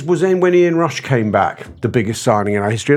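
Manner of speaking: ordinary talk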